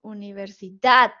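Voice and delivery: teacher's demo of "Universidad", final syllable emphasized; stress on last syllable. Shown as a demonstration. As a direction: build to a strong DAD in "Universidad". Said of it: "la sílaba que pronuncian diferente".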